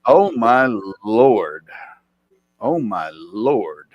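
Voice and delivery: drawn-out, dismayed "oh my lord", loud then sinking; loud, stretched-out exasperation; exasperated. Real, not acted. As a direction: groan it out slowly in disbelief. Reacting with exasperation to chat.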